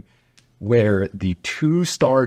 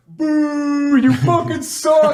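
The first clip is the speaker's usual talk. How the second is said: much higher mock boo, slowed and laughing; jeering crowd; 3 dB louder, much slower than usual. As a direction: jeer like a whole planeload of troops. Soldiers jeering as they leave.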